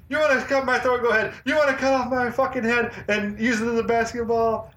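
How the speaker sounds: high-pitched mock movie rant; epic rant. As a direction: imitate a defiant hero ranting at his captor. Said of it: "Cash goes on that epic rant".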